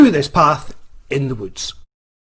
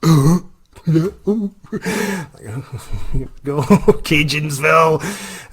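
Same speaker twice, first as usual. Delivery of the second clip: creepy driver; 4 semitones higher, 3 dB louder, much slower than usual. Retold or imitated. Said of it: "the guy's like".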